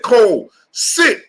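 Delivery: hammed-up shouted "Cole, sit"; mock command. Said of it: "He said".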